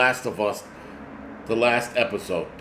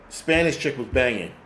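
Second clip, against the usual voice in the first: usual speaking voice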